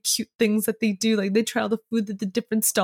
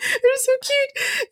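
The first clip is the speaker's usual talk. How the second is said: very high, drawn-out squeal; real gushing delight; much slower than usual. Real, not acted. Teller fangirling over the couple, high drawn-out gushing.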